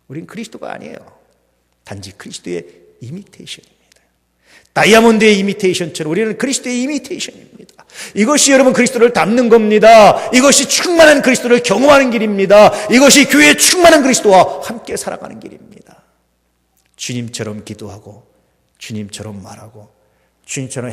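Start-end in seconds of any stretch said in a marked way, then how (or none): 4.7-16.0 much higher and louder, climbing proclamation; preaching at full force. Acted. Pastor proclaiming the way of Christ, full-force preaching. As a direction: build it up into a rousing declaration.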